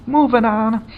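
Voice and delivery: high goofy voice. Real, not acted.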